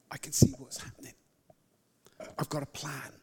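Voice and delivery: soft, quick whispered promise; reassuring whisper. Retold or imitated. God's promise voiced.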